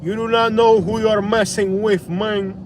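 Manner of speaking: usual speaking voice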